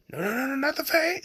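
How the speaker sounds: high, hammed-up panicked squeal; high mock-panicked squeal. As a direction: squeal like you're about to faceplant. Girl panics about falling.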